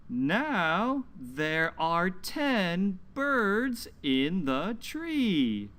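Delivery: slow, lilting picture-book reading; reading to children. Acted. Teacher reading a story aloud to children, slow and lilting. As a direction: read it slowly to little listeners, leaning on the numbers.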